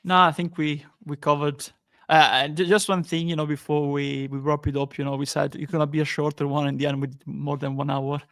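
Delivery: usual speaking voice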